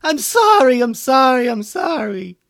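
very high, loud panicked begging, fading at the end; yelled pleading. A narrator's reading. Bones surrendering to the approaching elephants, yelling in panic. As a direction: beg for mercy in a loud squeal, then tail off. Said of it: "held up his hands and yelled".

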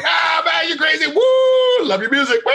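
very high, loud whooping fan shout; mock hype shout. Host hyping up rapper with fake whoops. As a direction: whoop like an over-the-top fan. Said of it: "And I was like".

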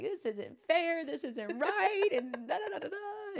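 hammed-up childish complaint; mock tantrum whine. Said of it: "I'm having a temper tantrum".

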